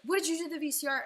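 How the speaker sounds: mock angry mother yelling; retold mom screaming. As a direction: bark it at her like an accusing mom. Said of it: "screamed at me".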